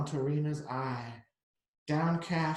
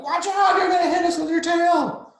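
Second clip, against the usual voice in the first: put-on high, loud kids' shout; kids crying out; 14 semitones higher, 12 dB louder than usual. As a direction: yell it up high like panicked little kids. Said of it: "They're like".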